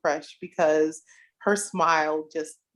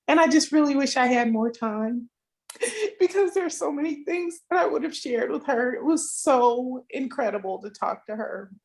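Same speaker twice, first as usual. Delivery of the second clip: high, choked-up grieving voice; tearful; 7 semitones higher than usual. Real, not acted. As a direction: fight back real tears while speaking. Tearful tribute to late friend.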